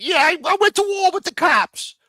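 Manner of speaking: high shouted mock bragging; mock boast. Mocking the arrested man bragging on a podcast, high and loud. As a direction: crow it loud like a wannabe tough guy. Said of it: "talking about".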